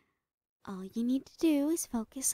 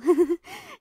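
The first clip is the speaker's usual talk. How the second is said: tense manic laugh; 8 semitones higher, 11 dB louder than usual. Acted. Tense laugh mid-sentence.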